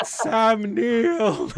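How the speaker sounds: high, drawn-out mock shout; imitating exasperated shouting. Streamer mimics viewers shouting at their computers, exasperated yell. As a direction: yell it like viewers screaming the answer at screens. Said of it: "just started shouting at their computers".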